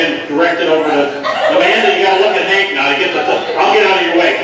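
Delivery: ordinary talk, nothing marked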